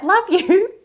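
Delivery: high, sing-song mock love you; teasing imitation of the stranger; mock call-out. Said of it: "calls out".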